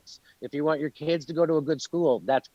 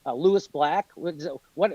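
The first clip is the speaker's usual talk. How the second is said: ordinary delivery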